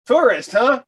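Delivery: knowing cop. Retold or imitated. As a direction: needle the tourist with a grin. Voicing the cop.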